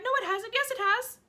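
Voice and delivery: excited. Acted. Aunt flip-flopping excitedly.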